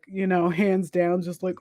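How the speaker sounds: usual speaking voice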